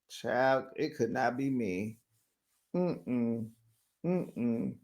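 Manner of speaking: low, quiet head-shaking refusal; soft, low mm-mm of disapproval; disapproving aside. Real, not acted. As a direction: drop right down and dismiss it. Muttered disapproval after the rant.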